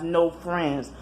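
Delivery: ordinary talk, nothing marked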